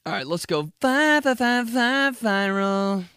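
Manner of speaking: high, loud stuttered intro; stutter jingle. Pretend. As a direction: stutter it like a DJ drop. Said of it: "stuttered jingle intro".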